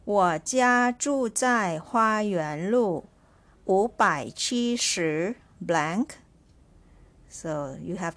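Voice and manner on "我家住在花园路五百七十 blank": slow dictation. Shown as a demonstration. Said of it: "Listen carefully".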